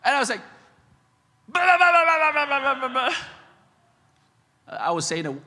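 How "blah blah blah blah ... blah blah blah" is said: loud, high babble in place of cursing; mock angry ranting. Dad venting over the desk, mock angry ranting. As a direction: rattle out furious nonsense, easing off. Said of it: "I was saying words of blessings".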